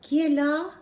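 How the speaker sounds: high, loud call in French from the dark; startled old Frenchman calling out. Acted. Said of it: "said a voice from the darkness".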